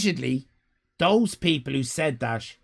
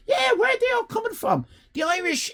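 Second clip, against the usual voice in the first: fast, much higher shout; can't believe how many turn up; amazed outcry; faster than usual. Acted. Amazed at many Irish viewers.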